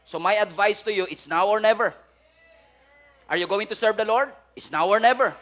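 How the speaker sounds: loud, insistent repeated challenge; urgent altar call. Acted. Preacher calling listeners to commit, urgent and loud. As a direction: press them to decide now.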